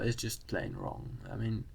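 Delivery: ordinary delivery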